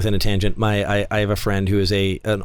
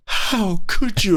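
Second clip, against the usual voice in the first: higher, slow, hammed-up indignation; mock outraged moviegoer; 10 semitones higher, much slower than usual. Imitating angry fans after Endgame, slow high outrage. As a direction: drag it out like a scandalized fan. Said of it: "people were like".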